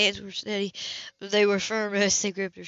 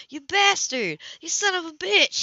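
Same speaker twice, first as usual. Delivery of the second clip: higher, louder furious outburst; furious yell; 7 semitones higher, 4 dB louder than usual. Acted. Boy confronts the man who hurt Grace, furious yell. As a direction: hurl the insults in a rage. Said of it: "Hot with fury".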